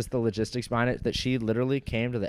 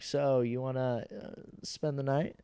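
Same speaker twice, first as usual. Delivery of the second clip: imitating her, slow, soft and higher; mock coy invitation; 3 semitones higher, 5 dB quieter, slower than usual. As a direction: draw it out like a hesitant come-on. Acts out her coy invitation afterwards.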